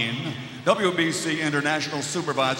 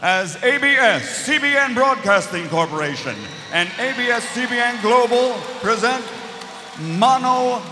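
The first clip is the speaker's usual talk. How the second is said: booming boxing ring announcer; 5 semitones higher, 4 dB louder than usual. Acted. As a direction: build it up like a big fight intro.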